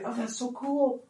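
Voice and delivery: high, soft gushing praise; softly squealed mock praise; gushing cheerleader. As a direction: coo it like an adoring parent. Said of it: "not gonna be a cheerleader".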